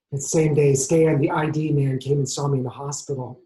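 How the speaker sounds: ordinary delivery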